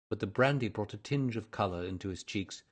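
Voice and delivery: usual speaking voice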